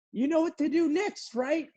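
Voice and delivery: very high mock lady voice; high knowing question. Lady quizzing the clueless narrator, very high mock voice. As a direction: squeak it like a know-it-all. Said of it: "she was like".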